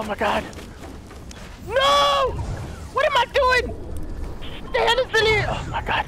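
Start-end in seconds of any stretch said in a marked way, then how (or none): 1.7-5.5 high, distressed cry; panicked yelling. Real, not acted. Gamer fumbling in a fight, panicked yelling. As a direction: yell in dismay at yourself. Mistake under attack.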